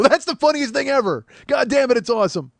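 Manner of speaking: high, loud hammed-up delight; imagined delighted fan. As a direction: shout it like a thrilled fan, playfully overdone. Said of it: "would he be sad or would he go".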